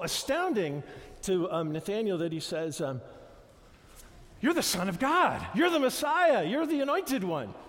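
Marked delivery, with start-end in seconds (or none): 4.4-7.5 loud, high, overwhelmed proclamation; awed loud exclamation. Acted. Nathanael crying out to Jesus, loud amazed proclamation. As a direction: cry it out as a stunned believer suddenly seeing the truth.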